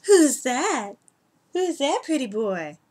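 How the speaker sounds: high, slow sing-song pet voice. Real, not acted. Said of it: "I talk to my cats like they're children".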